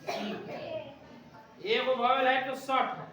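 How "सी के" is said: slow, long chanted answer; teacher chanting the answer slowly; drawn-out answer. Shown as a demonstration. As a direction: stretch each sound out for the class. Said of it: "क के लिए क्या देंगे?".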